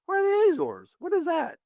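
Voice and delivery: high, baffled questions; a younger self totally lost; puzzled surprise. Retold or imitated. Author recalling his younger self, high and baffled. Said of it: "I was like".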